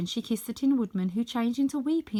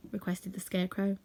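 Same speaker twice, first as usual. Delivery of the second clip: plain reading voice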